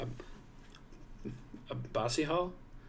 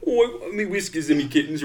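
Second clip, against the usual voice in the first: mock Scottish; 3 semitones higher than usual. Shown as a demonstration. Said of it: "three Scottish".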